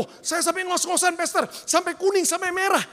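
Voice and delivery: protesting listener. Acted. Congregant boasting to pastor of exercising till breathless, high protest. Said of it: "Jadi kalau saudara bilang".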